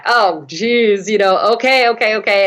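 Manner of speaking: dad caving to his daughter's plea; reluctant dad giving in. Retold or imitated. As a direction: give in with a weary okay. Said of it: "he was like".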